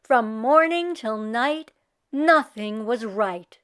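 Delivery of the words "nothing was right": plain reading voice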